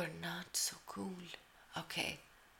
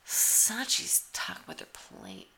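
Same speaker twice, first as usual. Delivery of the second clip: ordinary talk, nothing marked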